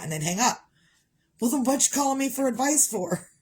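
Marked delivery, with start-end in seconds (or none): 1.4-3.3 higher, indignant question; exasperated. Real, not acted. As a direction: throw the question back at them. Exasperated rhetorical question to kids.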